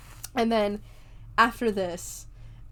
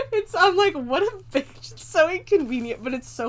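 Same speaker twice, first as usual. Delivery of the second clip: high, loud, cracking up; helplessly amused, voice pitched up; laughing exclamation; 8 semitones higher, 5 dB louder than usual. Real, not acted. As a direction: squeal it through laughter. Still cracking up over opening hours.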